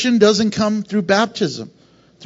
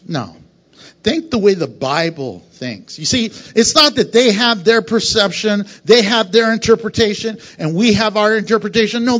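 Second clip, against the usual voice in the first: normal talking voice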